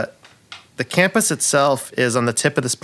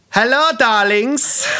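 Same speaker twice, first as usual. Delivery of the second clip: campy drag queen; 7 semitones higher than usual. Acted. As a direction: gush like a flamboyant diva. GM voices flamboyant bird NPC.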